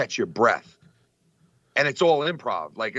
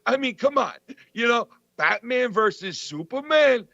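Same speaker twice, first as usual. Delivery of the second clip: high, mock-outraged Dice impression; imitating Dice; 11 semitones higher than usual. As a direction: ham up the comic's fake outrage, pitched up. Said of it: "out of nowhere, he goes".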